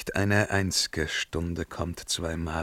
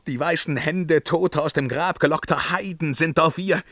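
rising horror; 7 semitones higher than usual. Acted. Horrified vision of the dead.

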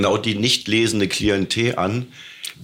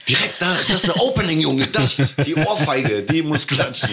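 excited; 7 semitones higher than usual. Real, not acted. Excited pitch about Tatort.

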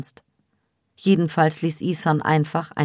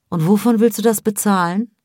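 higher, louder sceptical question; pointed, raised-voice challenge; challenging question; 6 semitones higher, 5 dB louder than usual. A narrator's reading. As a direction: ask it like a disapproving mother. Mother challenges daughter's plans.

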